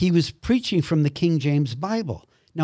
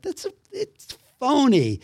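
scornful disbelief; 10 semitones higher than usual. Retold or imitated. Man scoffing inwardly at televised healings, scornful and high-pitched. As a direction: pitch jumps up in scornful dismissal.

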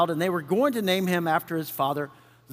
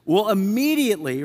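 usual speaking voice

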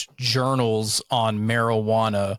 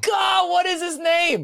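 very high, slow exasperated shout; frustrated shout; slower than usual. Real, not acted. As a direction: yell it out, fed up with your own memory. Frustrated trying to recall name.

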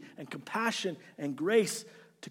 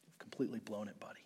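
mock whispered verdict; 6 semitones lower, 14 dB quieter, faster than usual.

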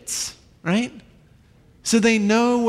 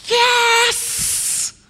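high delighted yelp; preacher imagining the reunion, high glad exclamation; joyful yes. Real, not acted. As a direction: burst out with joy.

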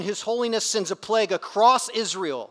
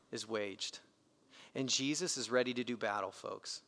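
intense hush; 7 semitones lower, 10 dB quieter than usual. Real, not acted. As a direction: lean in and say it close and grave. Preacher drops to intense hush.

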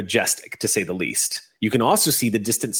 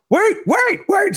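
high, squealed goblin cry; pleading goblin; 4 dB louder, slower than usual. Acted. Goblin calling after the car pulling out, high squeal. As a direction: squeal it out as a frantic little goblin.

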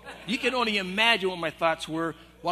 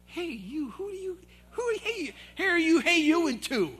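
high mocking squeak of the office manager; mocking inner voice; 11 semitones higher than usual. Pastor mocking the manager inside his head, high-pitched. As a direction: mimic her call, pitched way up and shrill in mockery. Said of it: "all going inside of my head".

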